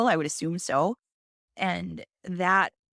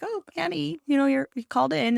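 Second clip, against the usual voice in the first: high, bright cheery greeting; cheery lab lady; 9 semitones higher, 4 dB louder than usual. Retold or imitated. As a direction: chirp it like an overly cheerful receptionist. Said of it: "the lady's all cheery".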